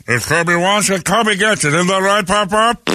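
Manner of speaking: teasing singsong. Pretend. Mocking the spoiled kid Kirby.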